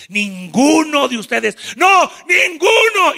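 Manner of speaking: loud high verdict; stern shout. Acted. The Lord refuses them all. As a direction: slam it down.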